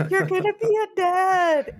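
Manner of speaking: high, squealed mock wail; hysterical crying imitation. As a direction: wail it out through pretend sobs, very high. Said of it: "like, hysterical".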